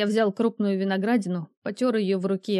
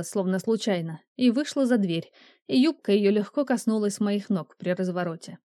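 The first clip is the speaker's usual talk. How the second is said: ordinary delivery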